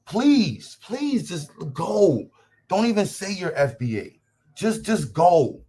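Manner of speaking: slow, drawn-out pleading brush-off; exasperated dismissal. Real, not acted. Streamer telling a chat troll to leave, slow exasperated. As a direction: wave the troll away, fed up.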